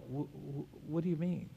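weak, halting, barely voiced; faint stammer. Dying patient answering the pastor, faint and stammering. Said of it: "And they go".

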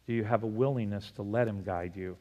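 ordinary delivery

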